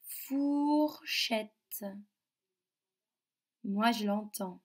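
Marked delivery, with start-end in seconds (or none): 0.0-1.8 "Fourchette" very slow, four held long; drawn-out OU sound. Shown as a demonstration. Teacher has children hunt for ou, slow stretched "Fourchette". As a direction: stretch the ou in "Fourchette", then a separate chette. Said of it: "le ou".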